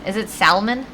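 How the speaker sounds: "salmon" with the L sounded, SAL-mun. Shown as a demonstration. Streamer asks viewers how it is said, sounded L in salmon. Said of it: "How do you say salmon?".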